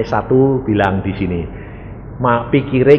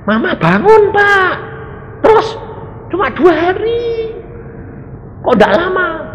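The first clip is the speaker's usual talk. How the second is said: much higher daughter's voice, lightly acted; voiced daughter. Daughter phoning the pastor, higher complaining voice. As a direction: play the daughter complaining on the phone.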